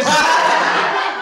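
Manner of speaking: quick airy laugh; breathy laugh. Real, not acted. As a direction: let out a short airy laugh. Laughs mid story. (tawa tertahan)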